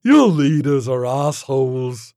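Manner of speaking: loud alien voice, slow at the start; scornful alien creature. Acted. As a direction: put the puny humans down breezily.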